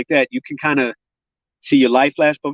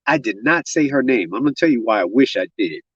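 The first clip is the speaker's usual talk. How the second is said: usual speaking voice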